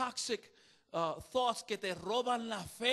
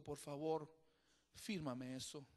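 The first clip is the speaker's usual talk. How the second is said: soft, much quieter, near tears; tearful plea; 7 semitones lower, 7 dB quieter than usual. Retold or imitated. Teacher with tears asks his former student, softly tearful. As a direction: plead gently, as if choking up.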